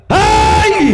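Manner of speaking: sudden high yell of an angry wife; sharp yell. Retold or imitated. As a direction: cut him off with a shout. Wife yells at brother.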